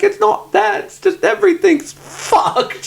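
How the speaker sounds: high, laughing imitation of a tearful mom; mock tearful breakdown. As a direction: play a mom falling apart, half laughing. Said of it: "Mom starts just breaking down in tears".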